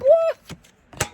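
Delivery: high, sudden whoa leap. Real, not acted. Caught up in her own words, a high whoa. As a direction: let it fly up in wonder. Exclaiming mid-sentence.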